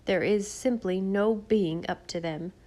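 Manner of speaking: ordinary delivery, nothing marked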